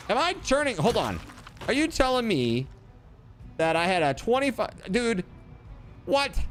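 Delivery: high, alarmed disbelief; can't believe the roll; shocked disbelief. Real, not acted.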